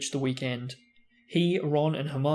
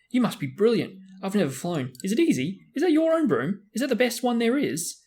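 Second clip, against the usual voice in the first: high, louder rapid-fire questions; eager questions; 8 semitones higher, 3 dB louder than usual. Acted. Young fan quizzing Harry about his broom, high and eager. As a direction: pepper him with excited questions.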